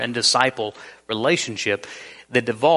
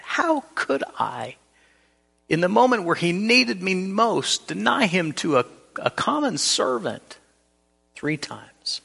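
anguished self-reproach, trailing into a whisper; devastated; 7 semitones higher than usual. Acted. Peter asking himself how he could deny Jesus, devastated. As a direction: ask it as a broken man who failed his friend. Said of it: "he had to be devastated".

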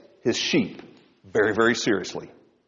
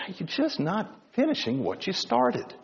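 light quiet rebuke; 7 semitones higher, 7 dB quieter than usual. Acted. Jesus voiced rebuking Sardis.

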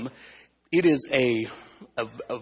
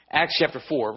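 ordinary talk, nothing marked

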